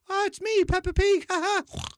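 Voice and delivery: very high, loud cartoon piglet; badly faked children's cartoon impression; Peppa Pig voice. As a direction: squeal a cheery introduction with a fake laugh. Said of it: "my Peppa Pig voice does not sound like Peppa Pig".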